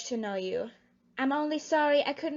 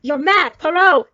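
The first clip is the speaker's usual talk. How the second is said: loud, outraged shout; cornered impostor shouting him down; furious outburst; 14 dB louder than usual. Acted. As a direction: lash out at the accuser. Exposed impostor lashes out.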